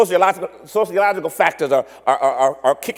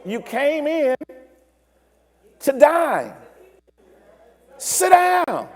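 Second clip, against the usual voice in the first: higher, puzzled waiter voice, climbing up to "Sit down"; mock indignant host, higher on the last line; baffled waiter; 5 semitones higher, slower than usual. The waiter scolds the impatient American, higher and baffled. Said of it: "they're looking at you like".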